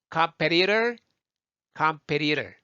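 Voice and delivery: competitor as "kam-pe-ri-rer"; American flaps in competitor; easy flapped T. Shown as a demonstration. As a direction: turn the Ts of competitor into R-like taps. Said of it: "말이 훨씬 편해요".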